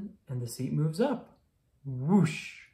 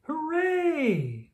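much higher, ringing cheer; cheering hooray; 13 semitones higher than usual. Acted. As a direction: celebrate the happy ending. Story's happy ending cheer.

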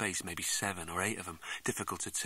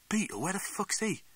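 higher, sudden startled question; 11 semitones higher than usual. Acted. Man hears his injured friend is home, startled. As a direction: jolt up in surprise at the name. Narrator startled hearing of Beetle.

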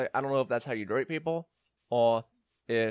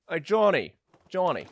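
raised, higher calling voice; 5 semitones higher, 6 dB louder than usual. Pretend. Player hailing an imagined kid, playful call. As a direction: holler a name across the way.

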